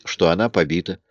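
ordinary delivery, nothing marked

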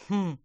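loud grumpy hmph; huffy sniff. Acted. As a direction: huff through the nose. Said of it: "засопел Помпон".

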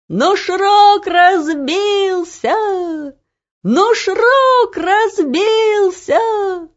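high, drawn-out triumphant cry; jubilant full-lunged shout. A narrator's reading. Guard crying out news to the whole town, drawn-out shout. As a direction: shout it out at the top of your lungs. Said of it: "Во всю силу лёгких кричал стражник".

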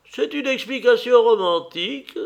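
amused exclamation. A narrator's reading. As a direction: exclaim in high delight, no real laugh. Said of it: "s'exclama en riant l'hôtesse".

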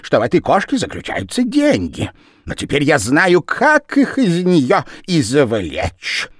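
high, loud showman voice; excited Japanese man. A narrator's reading. The Japanese man gloating to Lizzie, high and loud. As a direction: sell the idea with glee.

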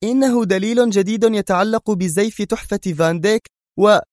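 high-pitched woman's voice, pressing on; insistent. Acted. Mona pushing new evidence on Bruce, insistent. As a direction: insist urgently until cut off mid-sentence. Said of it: "هتفت في إصرار".

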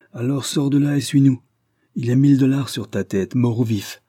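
narrated lawman calling out; sheriff's demand. A narrator's reading. Sheriff orders fugitive to surrender.

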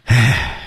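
demonstrated drawn-out sigh. Shown as a demonstration. Co-teacher leading learners into the line, sighing. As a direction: let out a deliberate sigh. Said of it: "一起叹口气".

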